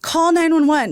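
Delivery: frantic shout for help; retold yell. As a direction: yell it at your husband in a panic. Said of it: "I, like, yell at my husband".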